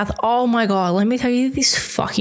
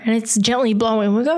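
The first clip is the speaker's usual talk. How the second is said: normal talking voice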